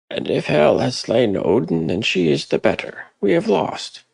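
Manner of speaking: high, loud, hopeless cry; despairing voice. Acted. Hrothgar telling Sigurd they have lost, high and loud.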